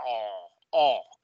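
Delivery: "O" as long open aw; open-throat sound. Shown as a demonstration. Teacher showing the sound of A, open vowel. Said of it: "Ese es el sonido de esa".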